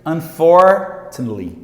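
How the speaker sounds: "Unfortunately" as un-FOR, then tnli, slow and loud; slow demo of shortened "Unfortunately"; reduced form. Shown as a demonstration. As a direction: stretch "for" in "Unfortunately", then swallow the rest. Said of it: "como se não tivesse isso aqui".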